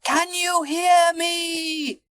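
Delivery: very high, slow, drawn-out call; high-pitched long-distance yell; shouting. A narrator's reading. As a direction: strain to be heard far away. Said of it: "shouted at the top of his voice".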